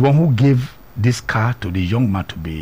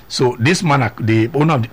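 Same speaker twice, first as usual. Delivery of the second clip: usual speaking voice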